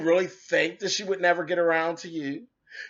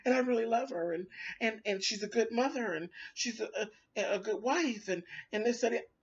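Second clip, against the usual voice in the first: higher, softer, stammering mock plea; mocking whiny quote; 4 semitones higher, 8 dB quieter than usual. Vlogger mocking his backpedaling praise, soft and stammering. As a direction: parody his backpedaling with breathy stutters.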